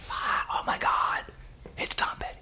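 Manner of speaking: awestruck, drawn-out hushed gasp; acted starstruck whisper; stunned whisper. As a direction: whisper it as the shock sinks in. Realizes the star is really calling.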